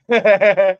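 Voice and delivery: short, loud laugh; amused laugh. Real, not acted. Streamer reading a viewer's flat tires joke, loud laugh. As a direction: burst out in a quick laugh.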